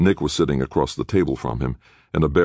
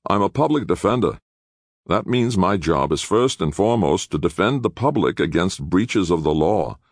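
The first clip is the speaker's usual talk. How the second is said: lightly voiced firm lawyer, pitched up; stern public defender; 6 semitones higher than usual. Attorney correcting his young clients, sternly. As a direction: lay down the job like a lawyer.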